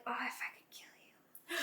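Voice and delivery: hushed, whispered mock threat; under the breath, softly murderous; muttered inner thought. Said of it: "I would think, like".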